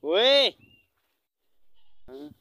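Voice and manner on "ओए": sharp high loud holler; calling out. Real, not acted. Vlogger hails someone at a distance, loud high call. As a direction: shout to get someone's attention.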